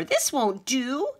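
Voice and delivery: a fed-up mouse with no bed; indignant mouse; annoyed mouse. A narrator's reading. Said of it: "squeaked Mortimer".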